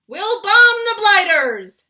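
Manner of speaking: high, very loud, slow blustering shout. Acted. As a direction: bellow it like a gung-ho air marshal. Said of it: "shouted the head of the Air Force".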